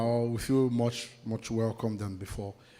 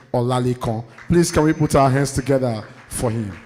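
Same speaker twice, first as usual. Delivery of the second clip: loud, rousing emcee call to the hall; emcee announcement; 2 semitones higher, 7 dB louder than usual. Acted. MC to the audience, calling for applause, loud announcer voice. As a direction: announce the next speaker and whip up applause. MC introduces next speaker.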